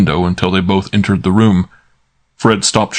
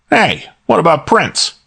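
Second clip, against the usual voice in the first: Fred's voice pitched higher, slow and grumbling; a gruff henchman pitched up, dragging the words; growled question; 10 semitones higher, slower than usual. Acted. Fred growls at Archie to mind Prince. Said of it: "when Fred growled".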